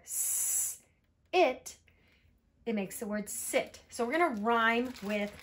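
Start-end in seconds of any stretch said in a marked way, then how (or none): 0.0-1.8 long hissed "s", then a punched "it"; sounding out. Shown as a demonstration. Teacher building a word, long "s" then "it". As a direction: blend in two parts, "s" apart from "it". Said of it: "if we put the S there".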